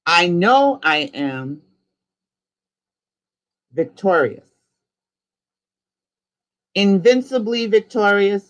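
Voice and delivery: rising, declaiming call with long gaps for repeating; slow decree. Acted. Leader calling out a decree for the group to repeat, slow and rising. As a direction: lift each "free" word by word, slowly.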